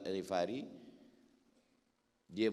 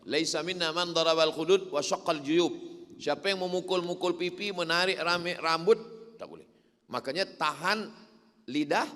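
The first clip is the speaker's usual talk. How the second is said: raised, loud preaching voice, pitched high; preacher in full flow, telling the story to the crowd; fiery preaching; 7 semitones higher, 8 dB louder than usual. Acted. Preacher tells the crowd of Abu Dzar's death, preaching loud and high.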